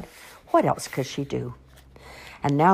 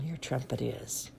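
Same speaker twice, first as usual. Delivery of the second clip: ordinary delivery, nothing marked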